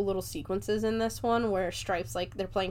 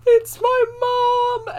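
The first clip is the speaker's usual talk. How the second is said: high, loud, drawn-out wail, slow; hammed-up crying to the grandkids; grandma mock-bawling about her mom; mock sobbing; 11 dB louder, much slower than usual. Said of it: "And I'm just gonna start crying".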